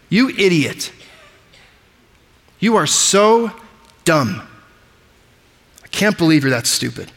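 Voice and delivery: a hint of a mocking bully; mock insult. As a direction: sneer it at a brother. Acting out an insult to a brother.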